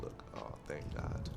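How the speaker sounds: ordinary talk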